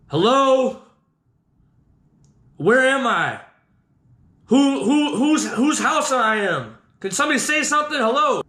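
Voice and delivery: high, hesitant call to a stranger; nervous calling out. Retold or imitated. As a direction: call out uneasily, stumbling over the questions. Calls downstairs to unseen stranger.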